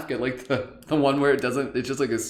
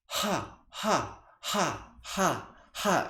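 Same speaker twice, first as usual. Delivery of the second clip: high, soft rhythmic ha ha on the exhale; demo breathy ha sounds; 7 semitones higher, 4 dB quieter, much slower than usual. Shown as a demonstration. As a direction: push each ha out short on the breath. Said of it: "So it's gonna sound like this".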